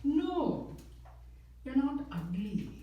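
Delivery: high put-on mother voice; consoling mom. Single Indian mom to son called fat, comically consoling. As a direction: play Mom reassuring her child, pitched well up. Joke voicing his mom's consolation.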